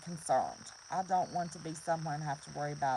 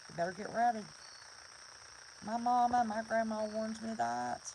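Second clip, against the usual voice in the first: usual speaking voice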